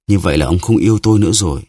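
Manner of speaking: ordinary delivery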